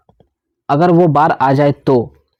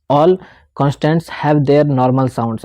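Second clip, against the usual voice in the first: slow reading; much slower than usual. Shown as a demonstration. Reading rule from book.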